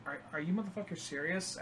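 muttered very softly, barely voiced; quiet exasperated mutter; under his breath. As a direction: say it to yourself so no one hears. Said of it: "I didn't say that".

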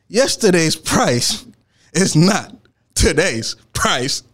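slow, weighty, every word set apart; slow emphatic motto. Real, not acted. Telling viewers his motto, slow and emphatic. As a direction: deliver the lesson slowly, like a slogan.

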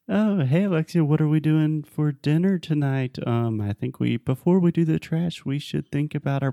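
high, evasive dodging voice; change the subject in a bright high voice. Pretend. Evasive husband pretend reply.

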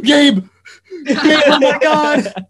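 high, loud, frantic shout to a friend; mock-panicked yell, breaking into laughter; re-enacted calling out.

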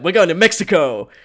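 high, loud gleeful yell; mock hijacker shout. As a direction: belt it out like a runaway hijacker. Imitates fake hijacker speeding away.

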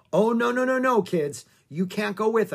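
high, loud protest, easing down; urgent refusal. Acted. Shawnee stops the kids climbing into the Jeep, urgently refusing.

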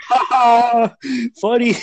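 high, acted-out cracking up; mimicked laughter. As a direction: imitate everyone dying laughing. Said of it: "laughing, like".

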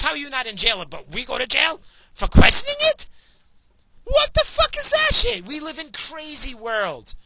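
yelling in real outrage at the government; real furious rant. Real, not acted. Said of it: "Yeah, I'm worked up".

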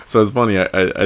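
ordinary delivery

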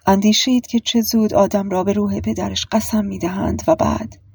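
usual speaking voice

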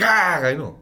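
sudden high loud angry outburst; Bill Burr impression. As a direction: blurt it out like an irate comic. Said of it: "That Bill Burr's like".

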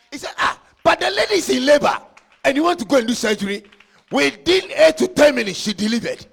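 loud, high, shouted preaching; preaching at full force. Acted. Preacher shouting to the congregation about demons, loud and high. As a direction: hammer it home to the church.

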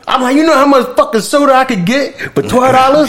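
high-pitched, heated rant about the price; outraged rant. Retold or imitated.

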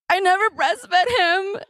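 high, drawn-out mock wail; retold near-tears. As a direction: act on the verge of crying, slow and high. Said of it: "like, starting to cry".

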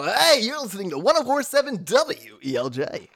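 exaggerated radio station ID, high and loud; hammed-up DJ swooping up on the call letters; fake radio voice. Shown as a demonstration. Former Connecticut radio worker showing his old on-air style, fake radio voice. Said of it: "I used to be able to do it really bad".

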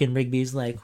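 ordinary talk

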